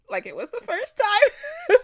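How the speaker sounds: high, loud, hammed-up squeal; mock first reaction. Re-reacting for viewers, a hammed-up high squeal. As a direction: pitch way up as if hearing it fresh.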